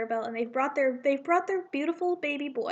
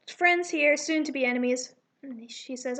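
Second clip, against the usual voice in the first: ordinary delivery, nothing marked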